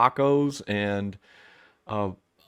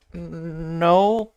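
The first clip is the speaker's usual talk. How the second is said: higher, louder blank "No"; a clueless official's pitched-up "No"; mock clueless no; 7 semitones higher, 5 dB louder than usual. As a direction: toss off the answer like an oblivious elite. Imagined clueless politician answering.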